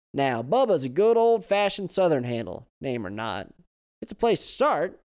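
put-on loud, high cheerful old man; smiling. A narrator's reading. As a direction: say it with a grin. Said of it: "smile in the man's voice".

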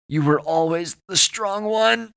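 slow, high, loud strained voice; agonised confession. A narrator's reading. Chance's last words to Owen, in agony. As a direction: push it out, rising through pain.

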